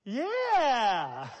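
put-on loud, high drawn-out child's cheer; imitated sudden elation. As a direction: burst into a little girl's delighted "Yeah". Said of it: "all of a sudden she went".

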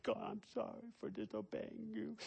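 high, soft sobbing apology; imitated tearful child. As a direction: imitate a little girl praying through sobs. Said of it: "she's crying".

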